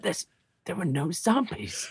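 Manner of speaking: softly hammed-up dying voice; mock dying words. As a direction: play a disappointed deathbed gasp for laughs. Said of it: "really disappointed when I die".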